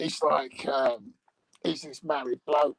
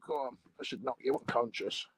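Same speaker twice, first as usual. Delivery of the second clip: ordinary talk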